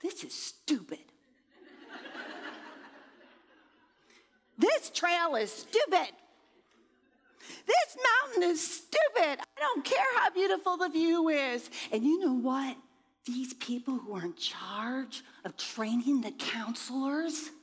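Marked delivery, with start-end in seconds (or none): 0.0-11.7 building exasperated rant. Retold or imitated. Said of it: "all I can think about is". 13.2-17.5 hushed, secretive whisper; conspiratorial whisper. Real, not acted. Grumbling to listeners about the camp trainers in a whisper.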